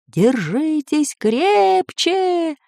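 high, very slow drawn call. A narrator's reading. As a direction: stretch the shout over the wind. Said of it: "выкрикнул учёный".

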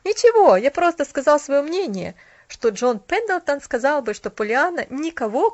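bold, triumphant declaration. A narrator's reading. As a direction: announce it with an air of triumph. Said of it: "повернулся к ней с триумфальным видом".

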